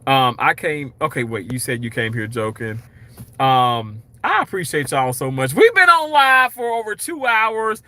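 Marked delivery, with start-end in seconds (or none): 5.5-7.8 excited announcement. Real, not acted. Excited sign-off to stream viewers.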